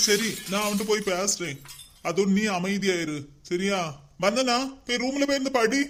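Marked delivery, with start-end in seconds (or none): 4.2-5.8 higher, rising to a loud order; stern scolding. Acted. Father ordering his daughter to go study, rising to a loud scold. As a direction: send her off to her room.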